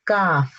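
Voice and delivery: काफ said gently, a light k from the mouth; soft kaf. Shown as a demonstration. Teacher contrasting the soft काफ. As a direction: say काफ softly, not thick. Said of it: "बल्कि आराम से बोलना है, नरम आवाज के साथ".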